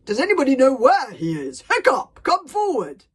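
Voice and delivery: high, booming summons; loud calling out. Acted. Stoic summons Hiccup from the crowd, loud commanding call. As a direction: call across the crowd with authority.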